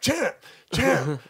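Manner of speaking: loud, high call across a crowd; yelling. Retold or imitated. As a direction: shout after him to turn around. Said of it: "because I'm yelling".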